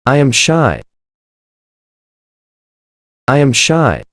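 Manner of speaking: usual speaking voice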